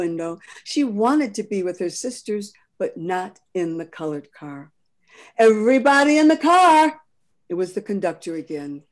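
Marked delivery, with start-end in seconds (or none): 5.4-7.0 a much louder, higher shout to board; conductor's order. Acted. Conductor hurrying the passengers aboard, loud and high. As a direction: order everyone into the car.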